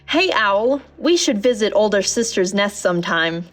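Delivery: ordinary delivery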